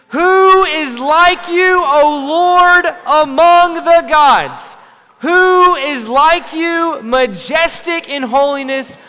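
loud, slow, high shouted scripture; group shout. Shown as a demonstration. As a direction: shout it out all together with full conviction. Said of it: "Can y'all shout it with me?".

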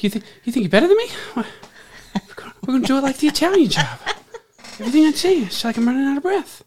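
fast, high, breathless Boston tough guy. Retold or imitated. Host mocking a Boston tough guy to his co-host, breathless and fast. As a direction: rattle it off like you're out of breath. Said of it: "You sounded like [name]".

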